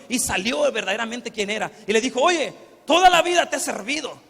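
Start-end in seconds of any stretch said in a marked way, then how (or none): 2.2-4.2 loud, higher, bitter reproach; resentful son shouting at his father; angry reproach. Acted. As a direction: throw the complaint at him, louder.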